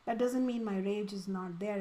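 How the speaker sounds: normal talking voice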